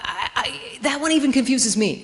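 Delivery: ordinary talk, nothing marked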